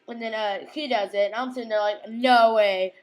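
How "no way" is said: excited. Pretend. Excited reaction to scene.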